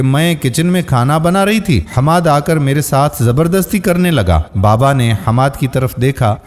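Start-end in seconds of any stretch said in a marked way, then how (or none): none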